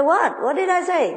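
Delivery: high, startled disbelief; the owner confused by the plumber's accusation; baffled. Retold or imitated. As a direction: act completely taken aback.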